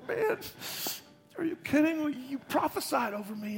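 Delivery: a nervous, overeager Peter pleading; eager Peter. Acted. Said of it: "He's like".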